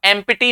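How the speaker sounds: "एम्प्टी" as em-pi-tee, p sounded; wrong pronunciation. Shown as a demonstration. Teacher showing what not to say, p pronounced. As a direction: put a full "pi" inside "एम्प्टी". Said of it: "नहीं बोलते इसे".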